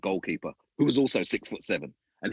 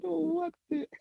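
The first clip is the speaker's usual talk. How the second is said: mock disbelief. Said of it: "I said".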